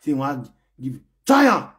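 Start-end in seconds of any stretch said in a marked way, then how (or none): 1.2-1.7 high, loud challenge; loud dare. Real, not acted. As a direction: fling the dare at him loudly. Daring the fan loudly.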